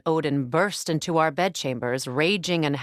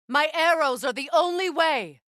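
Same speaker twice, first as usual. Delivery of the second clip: urgent shout; 9 semitones higher than usual. Acted. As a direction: push him to use the arrows. Urging ally in combat.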